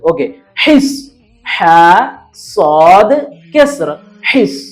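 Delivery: ഹ, സ്വാദ്, കെസ്ർ spelled slowly, then ഹിസ്; name each letter, then join them into ഹിസ് with a short vowel; spelling out ഹിസ്. Shown as a demonstration. Teacher spells the word for students, letter by letter. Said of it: "സ്വാദാണ് സീൻ പറയുന്നത്".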